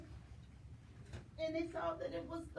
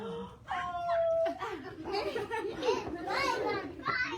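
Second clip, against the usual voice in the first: startled gasp; 7 semitones higher, 4 dB louder than usual. A narrator's reading. Wolf lands in hot water.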